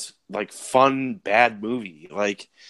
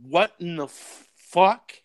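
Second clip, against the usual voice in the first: slow, higher, stunned what-the-fuck; baffled disbelief; 6 semitones higher, much slower than usual. Retold or imitated. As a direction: drag it out in mock disbelief. Reacting to the silly scene.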